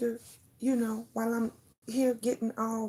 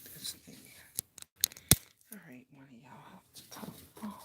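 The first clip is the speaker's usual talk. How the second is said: whispering; 2 semitones lower, 15 dB quieter, faster than usual. Real, not acted. Whispering off camera before starting.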